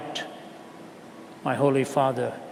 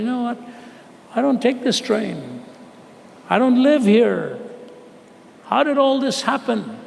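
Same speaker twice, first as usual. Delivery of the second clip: astonished outcry; 8 semitones higher than usual. Retold or imitated. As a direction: burst out in wonder at the impossible coincidence. Man marvels at the miracle.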